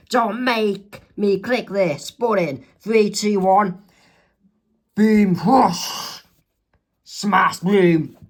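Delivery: slow threat building to loud boom; countdown threat. Acted. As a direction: count down then blast sound effects. Sharon threatens with watch.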